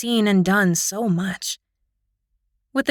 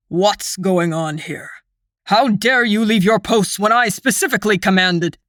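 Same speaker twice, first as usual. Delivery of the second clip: stern lord. A narrator's reading. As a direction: demand answers as the stern lord. Lord Faden's voice.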